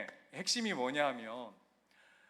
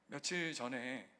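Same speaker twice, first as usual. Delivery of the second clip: normal talking voice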